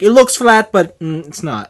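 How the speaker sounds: imitated Italian coach, starting high and loud, dropping off; mock Italian accent, tailing down; thick Italian accent. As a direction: explain it like an Italian coach, pausing on "mm". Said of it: "he's got this thick Italian accent".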